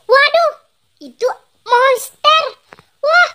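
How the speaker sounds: high startled waduh, then a slow drawn-out itu monster; a kid's shocked gasp at a monster; startled cry. Acted. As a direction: jump back at the sight of the monster.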